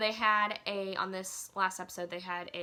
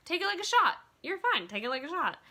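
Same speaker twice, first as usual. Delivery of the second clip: higher, louder team cheer; Ashley coaching her team, a mock loud, higher rally; retold urging; 8 semitones higher, 5 dB louder, faster than usual. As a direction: egg them on, pitched way up. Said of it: "telling her team".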